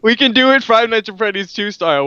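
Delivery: ordinary talk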